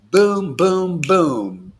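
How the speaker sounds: punchy boom, boom, boom; booming sound effect. Retold or imitated. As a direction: drop each boom like a hit. Acting out spiritual impact.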